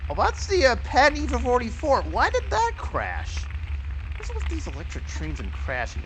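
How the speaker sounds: high, loud baffled exclaiming; stunned by the crash; shocked disbelief. Real, not acted. Watches his train crash.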